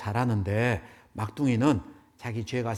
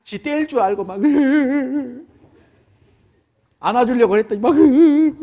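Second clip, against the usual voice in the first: high, loud whimpering; mock sobbing; 10 semitones higher, 12 dB louder than usual. Preacher telling the story, acting the youngest coming out in mock sobs. Child sobbing, expecting punishment.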